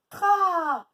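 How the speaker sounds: TRA high, loud, with a long a; stretched syllable. Shown as a demonstration. Teacher showing the second A, stretched syllable. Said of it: "A encore".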